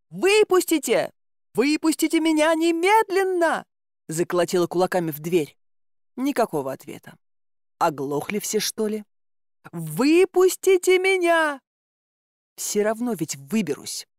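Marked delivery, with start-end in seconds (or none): 0.1-3.7 high, raised, urgent demand to be let out; требовательный крик. Acted. Пленница кричит запертой двери. 9.7-11.6 long, high, drawn-out shout; отчаянный крик. Acted. Пленница кричит запертой двери.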